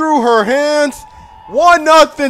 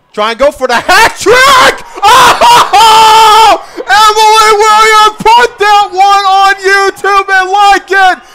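high, loud hat trick call; long goal yell; 14 dB louder, much slower than usual. Real, not acted.

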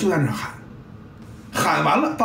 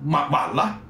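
ordinary talk

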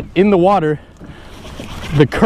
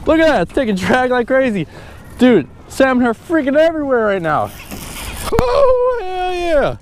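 ecstatic high whoops; fighting a fish; 13 semitones higher, slower than usual. Real, not acted. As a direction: shout with pure fishing joy.

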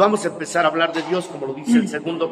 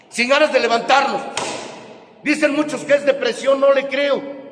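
raised preaching voice throughout; preaching at full force; 5 semitones higher than usual. Real, not acted. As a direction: preach it hard. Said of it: "preaching at full force".

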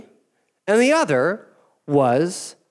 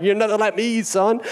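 condescending call-out; 5 semitones higher, 3 dB louder than usual. Acted.

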